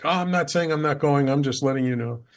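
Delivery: imitating wife's defensive protest; reluctant protest. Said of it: "Resistance, resistance, resistance".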